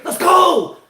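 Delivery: loud, high rallying yell; hyped-up shout. Real, not acted. Host hyping the action on the court, hyped-up shout. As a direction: shout it high to fire everyone up.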